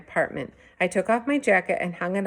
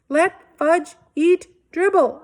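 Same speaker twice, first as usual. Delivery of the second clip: much higher, louder, slow scream; 12 semitones higher, 7 dB louder, slower than usual. A narrator's reading. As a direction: scream it in outrage. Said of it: "I screamed".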